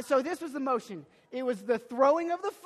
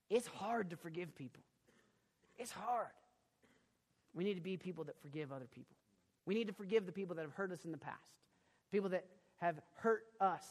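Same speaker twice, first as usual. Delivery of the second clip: soft pastoral urging; 4 semitones lower, 8 dB quieter than usual. Real, not acted.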